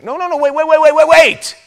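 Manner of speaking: frantic shout. Retold or imitated. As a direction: stop God in desperate protest. Moses protesting his death.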